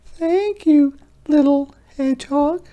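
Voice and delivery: very high, delighted tiny squeaks; squeaky mice. Acted. Field mice thank Hedgehog in high squeaks. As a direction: squeak with happy relief.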